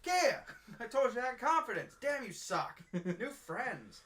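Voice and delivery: high, loud mock-offended rant; hammed-up hurt friend storming off; indignant rant. Lori's indignant rant retold.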